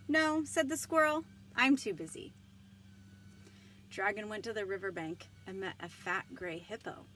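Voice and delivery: lively storybook read-aloud with voiced characters; reading to children. Librarian reading Dragon aloud to children, animated.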